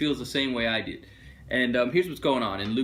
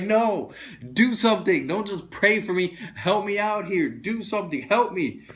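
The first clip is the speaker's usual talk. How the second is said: higher and louder, desperate demand; exasperated plea; 6 semitones higher, 4 dB louder than usual. Retold or imitated. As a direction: plead loudly for action, not prayer.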